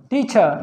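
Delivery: "टीचर" as tee-chuh; silent r. Shown as a demonstration. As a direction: end "टीचर" on uh without an r. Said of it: "र नहीं बोलते".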